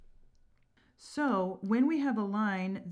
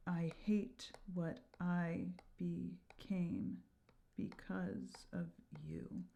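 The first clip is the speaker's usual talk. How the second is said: slow, far softer, fading whisper; whispered final line; 10 dB quieter, slower than usual. Shown as a demonstration. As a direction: breathe it out heavy with regret. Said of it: "our last line".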